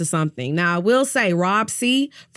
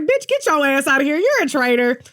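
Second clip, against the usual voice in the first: louder, higher mock accusing players; higher, louder mock group accusation; 6 semitones higher, 4 dB louder than usual. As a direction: bark it at her like the group. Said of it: "They was like".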